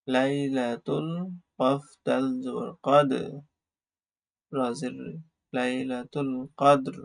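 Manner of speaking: drawn out slow teacher reading; slow model reading; slow blended reading. Shown as a demonstration. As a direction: stretch each syllable slowly for learners. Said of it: "slow joined reading of word".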